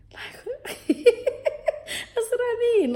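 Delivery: laughing through the words, high-pitched. Real, not acted. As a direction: crack up mid-sentence, drawn out. Cracking up at own joke.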